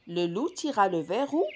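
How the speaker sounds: plain reading voice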